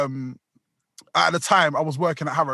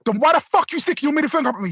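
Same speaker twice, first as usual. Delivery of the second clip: high, fast irate question; rapid-fire indignant challenge; acted angry demand; 9 semitones higher, faster than usual. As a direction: re-enact rowing with a driver. Retold row with other driver.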